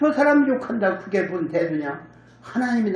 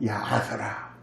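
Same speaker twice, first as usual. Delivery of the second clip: quiet restraint; 9 semitones lower, 8 dB quieter, slower than usual. Retold or imitated. David restrains his general.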